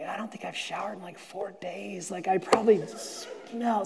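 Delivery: embarrassed mutter. Retold or imitated. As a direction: confess it quietly to yourself. Embarrassed inner thought.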